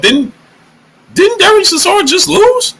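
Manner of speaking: high, rising incredulous question; can't believe he just lost; disbelief. Real, not acted. Streamer asking chat, in high disbelief. Baffled Chisora still wanted after loss.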